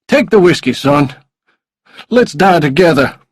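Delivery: high, loud villain voice; desperate, menacing man. A narrator's reading. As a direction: press the captive with wild insistence. Suicidal man orders captive to drink.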